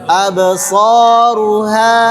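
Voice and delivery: "absoruha" slow with a soft, breathy-light ha; correct soft ha. Shown as a demonstration. Teacher models the right soft ha, slowly. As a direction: sound "absaruha" slowly, easing the ha soft.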